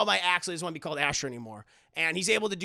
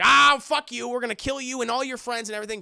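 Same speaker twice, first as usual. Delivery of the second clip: threatening god; 7 semitones higher, 7 dB louder, faster than usual. Retold or imitated. Zeus threatens the heroes.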